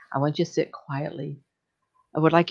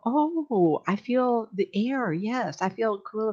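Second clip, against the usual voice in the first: slow awed guest; 4 semitones higher, much slower than usual. Retold or imitated. Voicing guest feeling air.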